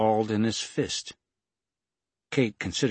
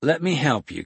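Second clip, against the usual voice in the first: higher, louder urgent plea; begging; 6 semitones higher, 6 dB louder than usual. A narrator's reading. Kate to the gunman over her wounded friend, begging. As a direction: plead urgently to be let through. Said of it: "Kate begged".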